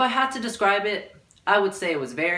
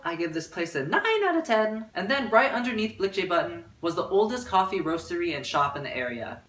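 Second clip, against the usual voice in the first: normal talking voice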